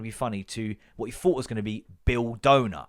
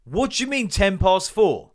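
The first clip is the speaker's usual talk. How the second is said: baffled retort; 6 semitones higher, 6 dB louder than usual. Retold or imitated. Baffled retort in phone argument.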